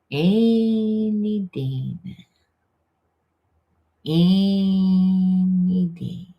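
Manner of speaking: dragged-out, very slow and drawly; real slow energy. Retold or imitated. Mocking the slow partner, very slow drawl. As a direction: stretch every syllable like it weighs a ton. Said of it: "And real slow energy".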